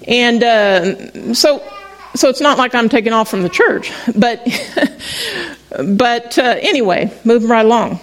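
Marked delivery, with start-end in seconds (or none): 4.5-5.9 breathy burst of a laugh; a quick laugh at her own joke; light amused laugh; short laugh. Real, not acted. Laughing at own joke about church.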